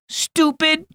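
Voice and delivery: put-on high child's yell; little girl shrieking in a temper; angry yell. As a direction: lash out at the dog. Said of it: "she yelled".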